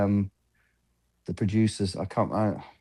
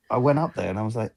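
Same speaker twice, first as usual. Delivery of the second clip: ordinary talk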